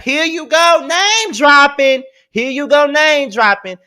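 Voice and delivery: loud, high mocking Here you go, name dropping; mocking scold. Real, not acted. Host mocking the tweeter for name dropping, loud and scornful.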